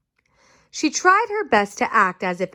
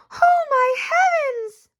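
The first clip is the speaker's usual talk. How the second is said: high, slow, shocked mother's cry; slower than usual. Acted. Mother shocked at her striped daughter, high slow cry. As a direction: gasp it out like a startled mom. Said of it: "she cried".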